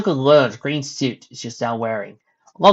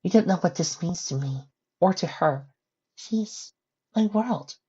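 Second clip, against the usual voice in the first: soft grateful mother; 6 semitones higher, 5 dB quieter than usual. Acted.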